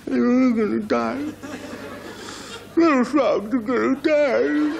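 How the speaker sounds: very high, slow, hammed-up wail; mock weeping frog. Frog alone on the riverbank, in a hammed-up tearful wail. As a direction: blubber like a tiny doomed frog.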